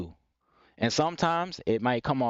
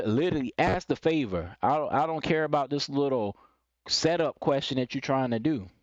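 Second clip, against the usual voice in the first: normal talking voice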